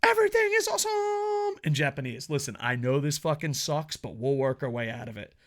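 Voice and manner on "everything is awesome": very high, cartoonish hype voice; hammed-up cheerful promo, pitched way up; mock promo voice. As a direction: squeak it out like a cheesy ad.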